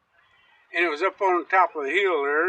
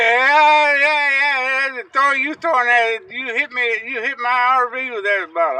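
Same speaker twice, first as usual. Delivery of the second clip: loud, higher, indignant repeated yeahs; retold angry hollering; 7 semitones higher, 8 dB louder than usual. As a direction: rant at him like he wrecked your RV. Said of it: "he said".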